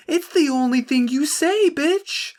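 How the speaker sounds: high, incredulous rant; up-pitched outraged rant; exasperated. Real, not acted. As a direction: vent total disbelief.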